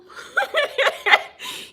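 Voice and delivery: high giggling laugh; real high, tickled laughter. Real, not acted. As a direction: crack up at your own story. Laughs at own meek reply.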